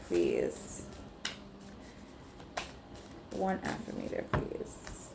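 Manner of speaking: fading into a voiceless whisper; soft whispered plea; hushed request. Real, not acted. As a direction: whisper the ask to the deck.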